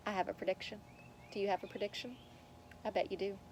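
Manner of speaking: softly, almost under the breath; quiet storyteller aside; hushed aside. Real, not acted. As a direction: lean in and confide it quietly. Narrator's hushed aside to listeners.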